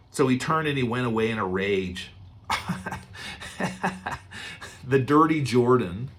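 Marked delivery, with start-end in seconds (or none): 2.5-4.8 breathy laugh. Real, not acted. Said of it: "laughs at the story".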